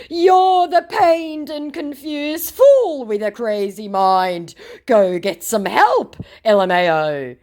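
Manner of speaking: loud, high, drawn-out taunt; mock scammer voice. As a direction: ham up a slow, indignant insult. Voices scammer's insult in text quarrel.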